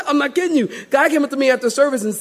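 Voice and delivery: ordinary talk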